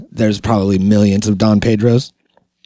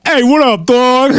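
much higher, put-on hey what up dog; mock street greeting; 12 semitones higher, 4 dB louder, slower than usual. Host imagines greeting a cashier, hammed-up slang voice.

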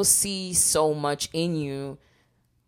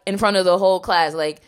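usual speaking voice

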